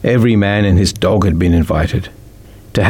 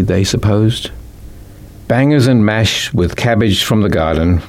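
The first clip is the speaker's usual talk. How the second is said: ordinary delivery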